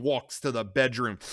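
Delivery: ordinary delivery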